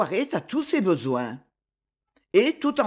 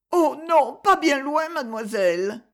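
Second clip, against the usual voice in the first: put-on boy's voice; 6 semitones higher than usual. A narrator's reading. Boy answers Myrto.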